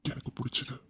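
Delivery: low, breathy romantic whisper; seductive whisper. Acted. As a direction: breathe it close to her ear. Husband's seductive whispered confession. (காதல் கிசுகிசுப்பு)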